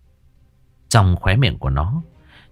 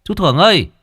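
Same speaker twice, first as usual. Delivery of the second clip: loud, higher calls across the yard; calling out; 8 semitones higher, 4 dB louder than usual. A narrator's reading. Thiên calling for old Thưởng across the yard, loud. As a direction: shout for the missing man.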